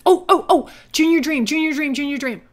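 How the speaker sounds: high, loud, urgent exclamations; excited. Real, not acted. Gamer spotting a Junior Dream, excited. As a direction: burst out as a prize pops up. Goal pops up in game.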